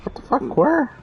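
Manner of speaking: high, breathless what the fuck; shocked. Real, not acted. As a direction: gasp it out in sudden shock. Sudden scare in game.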